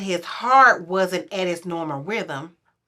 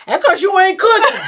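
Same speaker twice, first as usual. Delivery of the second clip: high, loud mock husband excuse; mock his deflection, high and loud; high teasing husband voice; 12 semitones higher, 7 dB louder than usual. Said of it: "And you know men".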